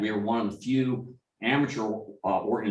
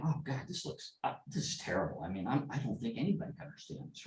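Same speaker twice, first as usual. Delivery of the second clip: quiet dismay, barely voiced; hushed worried thought; 11 dB quieter, faster than usual. Recalling his doubt over the neighbor's Cyrillic translation, hushed and worried.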